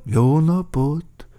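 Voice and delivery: slow, drawn-out hello, moderately high; leisurely echoing newcomer; second character's greeting. A narrator's reading. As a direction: echo the greeting, stretching it out. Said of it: "mondták".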